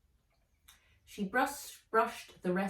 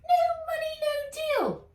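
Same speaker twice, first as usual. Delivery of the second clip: very high, loud, final elf voice; curt elf; 6 dB louder than usual. Acted. Elf refusing Nat, high and final. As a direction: shut the deal down flat. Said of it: "With a flip of her stick".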